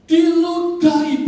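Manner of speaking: high, loud, long-held word; impassioned cry. Acted. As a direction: cry it out from the pulpit. Same word cried out at climax. (seruan lantang berkepanjangan)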